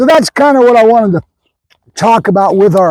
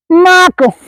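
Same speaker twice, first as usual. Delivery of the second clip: calling the dog; 11 semitones higher than usual. Real, not acted. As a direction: call him back over. Calling dog by name at sign-off.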